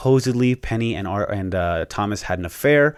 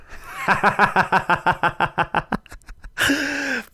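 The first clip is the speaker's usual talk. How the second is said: delighted laugh; 12 semitones higher than usual. Real, not acted.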